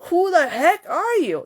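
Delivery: high-pitched, indignant telling-off; hammed-up affronted outburst; mock angry yelling. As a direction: act out scolding someone with exaggerated outrage. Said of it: "Don't yell at the tree leaves".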